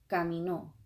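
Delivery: "caminó" with weight on its last syllable; final-syllable stress. Shown as a demonstration. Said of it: "emphasis on the last syllable".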